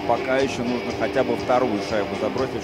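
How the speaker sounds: normal talking voice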